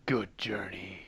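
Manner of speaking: low, slow whisper; hushed farewell. Acted. Game master as a character bidding travellers farewell, hushed. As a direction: breathe it out softly and low.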